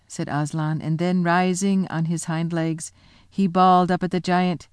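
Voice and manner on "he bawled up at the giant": plain reading voice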